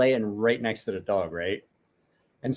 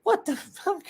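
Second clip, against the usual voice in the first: squeaky high what-the-heck; stunned high yelp of disbelief; shocked disbelief. Retold or imitated. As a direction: voice jumps way up in shock. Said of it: "I was like".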